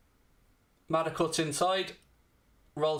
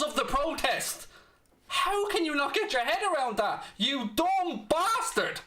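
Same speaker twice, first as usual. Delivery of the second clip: angry rant; 6 semitones higher than usual. Real, not acted. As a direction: voice climbing high in exasperated fury at the chat. Furious rant at chat viewer.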